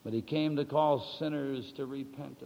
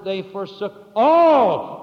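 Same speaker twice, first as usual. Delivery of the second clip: all drawn out, loud and high; stress on all; 5 semitones higher, 13 dB louder, slower than usual. Shown as a demonstration. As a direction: lean hard on the word "all" slowly. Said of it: "Emphasis on the word all".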